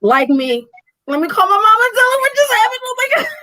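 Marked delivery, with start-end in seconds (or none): 1.1-3.3 high, fast, giddy chatter; giddy rush. Retold or imitated. Owner eager to call her mom, high fast giddy gush. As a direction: gush like you need to tell everyone now.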